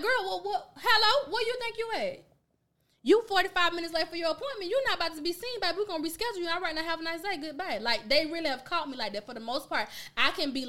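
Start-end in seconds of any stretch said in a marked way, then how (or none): 0.0-2.2 high-pitched, laughing disbelief; incredulous confrontation. Acted. Receptionist to a late client, high-pitched incredulous challenge. 3.0-7.8 high-pitched mock customer-service send-off; brisk dismissal. Receptionist turning away a late patient, high dismissive send-off.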